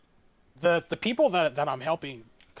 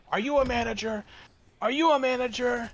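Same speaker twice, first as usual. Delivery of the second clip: demanding customer; 8 semitones higher, faster than usual. Retold or imitated. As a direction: insist on a manager, pitched up. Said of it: "the guy yelling".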